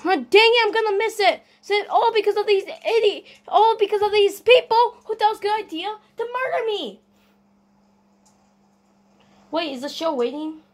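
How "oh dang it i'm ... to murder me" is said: high, loud, indignant complaint; exasperated rant. Pretend. Gamer about to miss the show because of killers, exasperated rant. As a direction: protest loudly in a raised voice. Ranting about missing the concert.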